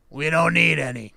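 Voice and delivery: loud, gruff man's voice; rough voice man. A narrator's reading. Man turning away maid with fresh towels, rough voice. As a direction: bark it out roughly through the door. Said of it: "the rough voice man responded with".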